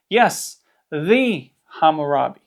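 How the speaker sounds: normal talking voice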